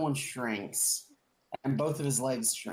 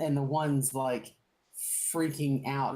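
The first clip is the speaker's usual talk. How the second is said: ordinary talk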